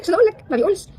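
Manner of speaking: a frustrated outburst about not being asked; exasperated complaint. Retold or imitated. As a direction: blurt it out in frustration.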